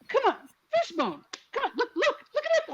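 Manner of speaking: high-pitched pleading, half laughing; hammed-up pushy parent; imitated eager coaxing. Acting out coaxing her daughter.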